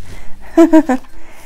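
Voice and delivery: light giggle to finish. A narrator's reading. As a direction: let out a little giggle. Closing Minnie's giggly line.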